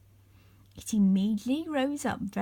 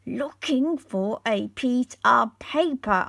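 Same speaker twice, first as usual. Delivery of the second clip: surly; 3 semitones higher, 7 dB louder than usual. A narrator's reading. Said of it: "answered the little man in a surly tone".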